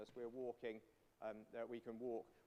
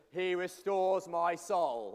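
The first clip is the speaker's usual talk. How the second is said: restores my soul, slow and loud; slow, emphatic recital; 3 semitones higher, 8 dB louder, slower than usual. Shown as a demonstration. Leader drilling the congregation: slow, loud emphasis. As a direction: lean hard on every word of "He restores my soul". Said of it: "we've got to emphasize that doubly".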